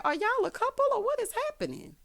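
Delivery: high-pitched baffled outburst; incredulous question. Retold or imitated. Viewer asking if they're a couple, high-pitched disbelief. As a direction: ask it way up high, bewildered. Baffled aside at the arguing pair.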